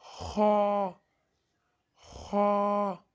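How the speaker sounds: खा rasped from the top of the throat, heavy; Arabic kha. Shown as a demonstration. Teacher demonstrating kha to viewers, rasping and heavy. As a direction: scrape खा from the upper throat. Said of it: "ये हलक के ऊपर वाले हिस्से से अदा होता है".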